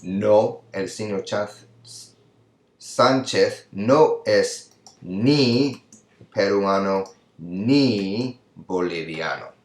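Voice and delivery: slow, careful model sentence for students. Shown as a demonstration. Teacher modelling the answer slowly for students. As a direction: spell it out word by word for the class. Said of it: "So you would say".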